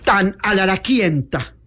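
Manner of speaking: usual speaking voice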